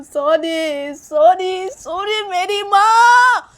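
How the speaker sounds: high, drawn-out protest after a slap; wailing plea. Acted. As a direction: cry out and beg, stretching every phrase. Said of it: "Sneha को ऐसे चिल्ला के बोलती है". (चिल्लाकर गिड़गिड़ाते हुए)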